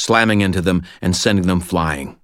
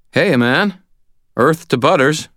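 high, loud rousing call; calling out loudly; 9 semitones higher, 4 dB louder than usual. Acted. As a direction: raise your voice to wake him. Trying to rouse friend.

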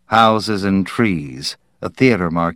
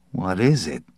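muttered; 5 dB quieter than usual. Acted. Parkhurst watching workers flee, muttering quietly. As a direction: mutter it under your breath. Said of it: "Parkhurst muttered".

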